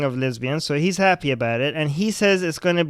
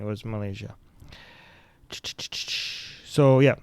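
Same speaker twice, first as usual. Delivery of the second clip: ordinary talk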